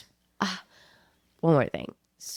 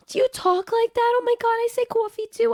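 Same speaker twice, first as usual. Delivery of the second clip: very high, louder excited waitress impression; 4 dB louder than usual. Waitress asks customer if she is from New Jersey, high-pitched excitement. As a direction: squeal it as a thrilled Jersey waitress.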